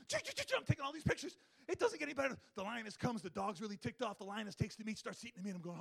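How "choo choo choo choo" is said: camera shutter noise. Retold or imitated.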